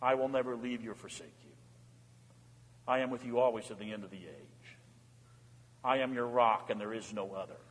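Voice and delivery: soft, low and tender, as God promising; quiet, comforting promise to his children; God's reassuring voice. Acted. God comforting his suffering children, soft and tender. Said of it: "He's a father who comes".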